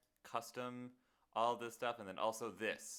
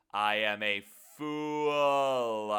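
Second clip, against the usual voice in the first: slow, loud mock lament; dramatic self-mockery; 2 semitones higher, 8 dB louder, slower than usual. Real, not acted. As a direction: wail it like a tragedy.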